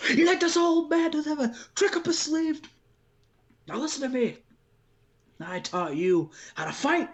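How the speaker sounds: put-on a proud old dad talking his son into the fight; old dad pep talk. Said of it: "he's like".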